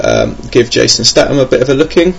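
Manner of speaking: usual speaking voice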